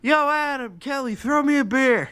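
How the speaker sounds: high, loud shout across a pool; calling out. Retold or imitated. Partygoer in the pool to friends on the deck, calling out. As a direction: holler to your friends for a beer, high and loud.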